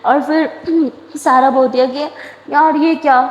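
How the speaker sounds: ordinary delivery, nothing marked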